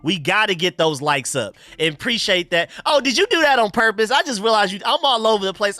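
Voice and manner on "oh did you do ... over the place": sudden high, louder realization; caught off guard. Real, not acted. Streamer realizing a viewer's donation was a troll, surprised. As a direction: blurt it out as the joke dawns on you.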